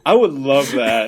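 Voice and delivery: loud, drawn-out enthusiasm; slow, emphatic excitement; real delight. Real, not acted. Delighted at the idea.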